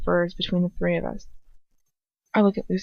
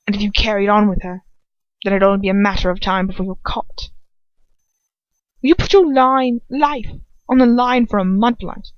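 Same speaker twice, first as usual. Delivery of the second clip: heated warning; 2 semitones higher, 6 dB louder than usual. Acted. Draco challenging his father, loud and heated. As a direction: drive the threat home.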